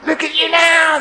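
loud, high mocking yell; jeering yell. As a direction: yell it up at the man on the cross. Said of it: "they yelled at him".

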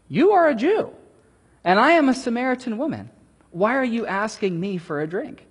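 high, quick, incredulous; astonished woman. Retold or imitated. Samaritan woman questioning Jesus at the well, high and amazed. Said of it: "She said".

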